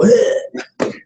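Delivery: a hammed-up exasperated ugh; mock annoyed groan; mock frustrated groan. Shown as a demonstration. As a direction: blurt out a fed-up grunt. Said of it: "Did I go nuts and go".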